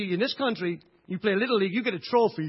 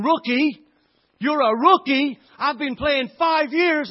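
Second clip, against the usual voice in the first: put-on boy yelling a jeering insult, much louder and higher; taunting shout; 7 semitones higher, 12 dB louder, slower than usual. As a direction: scream it in a bully's face, slow on the last line. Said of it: "he starts shouting".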